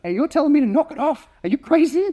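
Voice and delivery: high-pitched, incredulous protest; comic outrage as a disbelieved dog; indignant dog. Acted. Dog arguing with owner about a serious threat, high indignant protest. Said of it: "because the dog's like".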